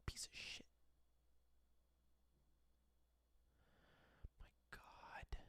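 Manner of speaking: barely audible breathy muttering; dismayed whisper. Real, not acted.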